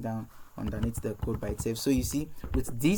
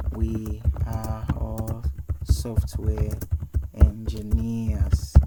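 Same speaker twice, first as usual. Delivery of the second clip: words spaced out slowly while typing; slow dictation; 3 semitones lower, 3 dB louder, much slower than usual. Shown as a demonstration. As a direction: dictate it slowly as you type. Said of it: "typing dictation slowly".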